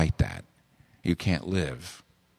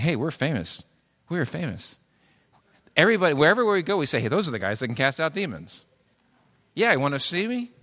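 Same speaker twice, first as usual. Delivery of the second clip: higher, bragging and cocky; mock brag of new celebrities; boastful disciples; 6 semitones higher than usual. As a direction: show off like someone who just got famous. Disciples boasting of their fame.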